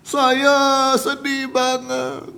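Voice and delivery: hammed-up slow wail, pitched high; mock weeping. Preacher offering another guess at the victim's words, mock weeping. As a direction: stretch every word into a pretend sob. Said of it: "dia menangis".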